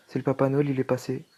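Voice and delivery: ordinary talk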